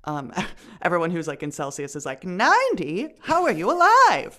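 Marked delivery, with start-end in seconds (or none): 2.2-4.4 high, loud incredulous outburst; mock disbelief. Imitating shocked Celsius users hearing the temperature, loud, high disbelief. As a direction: imitate someone aghast, pitch shooting up.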